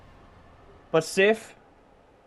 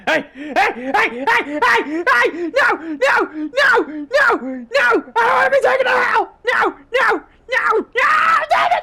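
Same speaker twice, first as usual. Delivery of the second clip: rising panicked shouts, much higher and louder; panicked yelling; 12 semitones higher, 8 dB louder, slower than usual. Real, not acted. Player being carried off to hell, screaming in a high panic. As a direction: yell in mounting panic as you're dragged away.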